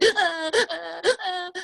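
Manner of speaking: put-on high wailing baby sobs; high, strained baby cry; imitated baby crying. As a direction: mimic a toddler's wail. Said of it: "she would be like".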